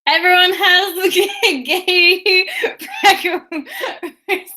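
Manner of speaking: high, loud, cracking up mid-sentence; words breaking up into laughter; real laughing. Real, not acted. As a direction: squeal it through giggles. Cracking up over the freckle.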